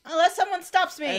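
loud, high, hammed-up hint; melodramatic hint. Co-host voicing the alien hoping for rescue, melodramatic. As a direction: call it out, fishing to be stopped. Joining the mock suicide vow.

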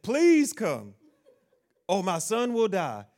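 loud, high mock plea with a laugh; begging father. Preacher replaying the desperate father's plea, loud and high. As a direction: cry out please come.

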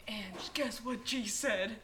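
slow, hushed secret whisper; slow conspiratorial whisper; whispered gossip. Retold or imitated. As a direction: whisper it like late-night gossip. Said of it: "I was like".

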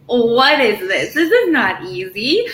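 slow, laughing through the words; half-laughing, stretched-out disbelief. Retold or imitated. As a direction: laugh out the shock of the test. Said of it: "and I was like".

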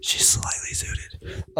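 soft slow breathy whisper. Shown as a demonstration. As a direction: hush it like ASMR. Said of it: "Do a whisper time".

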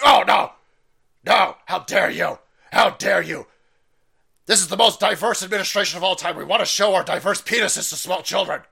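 loud, hammed-up indignation; mock outrage. Mimicking outraged opponents answering an objection, mock indignant shout.

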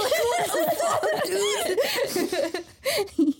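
high, slow stretched-out squeal; shocked squeal. Retold or imitated. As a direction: squeal it out in disbelief. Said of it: "be like".

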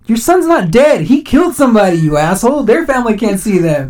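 indignant retort. Retold or imitated. Podcaster retorting to the convicted officer's mother, indignant. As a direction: raise pitch and volume in outrage. Retold angry comeback to the mother.

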